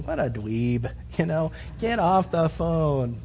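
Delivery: quiet, low scornful aside; lightly mocking girl. Preacher voicing the girl dismissing the caller, quietly. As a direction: mutter it like a bored girl. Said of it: "she's going".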